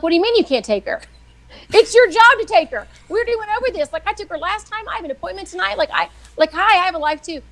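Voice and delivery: hammed-up indignation, voice climbing high; retold livid rant. Wife berating husband who won't take their daughter, livid and high-pitched. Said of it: "I was livid".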